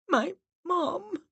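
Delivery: put-on high-pitched tearful girl; muffled sobbing. A narrator's reading. Miranda answering the medium, muffled and sobbing. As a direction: choke it out through sobs. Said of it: "sobbed and replied in a muffled voice".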